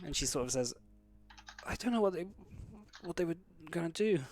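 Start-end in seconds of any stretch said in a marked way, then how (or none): 1.6-4.2 shaken. Acted. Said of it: "sort of shaking".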